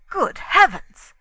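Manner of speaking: high, loud exclamation; shocked cry. A narrator's reading. Inglethorpe starting up at the accusation, crying out. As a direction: cry out in astonishment. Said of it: "cried Inglethorpe".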